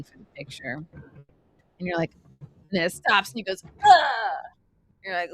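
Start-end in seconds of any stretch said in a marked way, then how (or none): 3.6-4.4 loud, higher acted 'ugh'; mock impact groan; jolted grunt. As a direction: grunt as if slammed to a stop. Imitating jolt when ride stops.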